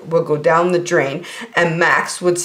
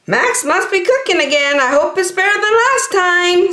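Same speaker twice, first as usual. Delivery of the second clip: put-on high, wry kid voice; cheeky child voice; teasing sister; 12 semitones higher than usual. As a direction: poke fun at Max's cooking. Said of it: "said Dakota".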